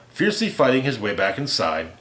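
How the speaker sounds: usual speaking voice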